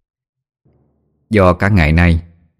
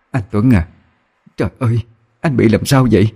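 a mild whimper rising higher toward the end; whimpering call; 4 semitones higher than usual. Acted. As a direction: plead tearfully with her husband to wake. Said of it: "miếu máu gọi".

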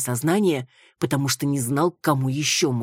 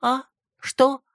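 startled; 12 semitones higher, 5 dB louder than usual. A narrator's reading. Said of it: "резко открыла глаза".

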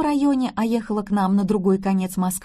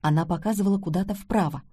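ordinary delivery, nothing marked

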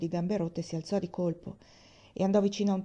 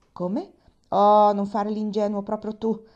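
louder and slow, dripping with sarcasm; sarcastic; 3 semitones higher, 9 dB louder, slower than usual. A narrator's reading. Nancy mocking old Tom for playing dumb: slow, loud sarcasm. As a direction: drag it out, mocking his innocence. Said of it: "disse sarcastica".

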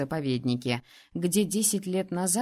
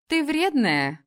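much higher, louder playful accusation; teasing complaint; 7 semitones higher, 5 dB louder, slower than usual. A narrator's reading. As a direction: pout it at your friend. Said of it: "заявила подруга".

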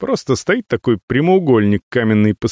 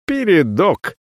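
snorting; 7 semitones higher, slower than usual. A narrator's reading. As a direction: snort the word. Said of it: "фыркнул Гетейнир".

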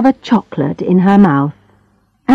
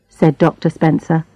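plain reading voice

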